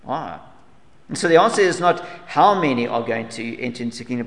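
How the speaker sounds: ordinary talk, nothing marked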